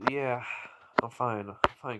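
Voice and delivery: soft, offhand mumble; quiet reassurance. Acted. Naruto tells the Hokage he's fine, quietly reassuring. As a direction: brush off the worry softly.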